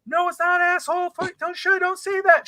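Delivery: very high-pitched imitation of a scolding wife; mock cussing wife. Wife cussing at her husband in the background, mock indignant. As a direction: protest in a squeaky put-on wife voice. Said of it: "She's cussing up in the background".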